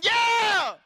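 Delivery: long, high, very loud holler; retold shout back. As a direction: yell it back, matching his excitement.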